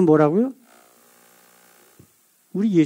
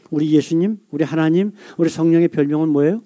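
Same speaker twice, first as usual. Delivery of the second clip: ordinary delivery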